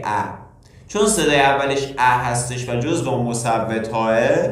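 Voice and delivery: ordinary talk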